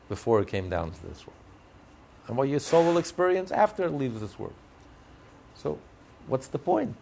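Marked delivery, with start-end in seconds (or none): none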